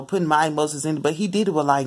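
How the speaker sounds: ordinary talk, nothing marked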